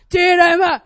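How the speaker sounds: loud, imitated one-word order; dad's commanding shout. Dad ordering the puppy in, shouting. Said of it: "Like that".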